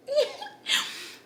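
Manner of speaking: a short giggle that rises high; high little laugh. Real, not acted. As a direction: laugh at your own joke. Laughs after mock threat.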